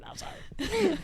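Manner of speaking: embarrassed whisper; whispered apology. Retold or imitated. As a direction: breathe the sorry out, barely audible. Sheepish apology for farting.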